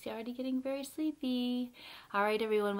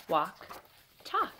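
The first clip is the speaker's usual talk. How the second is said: ordinary delivery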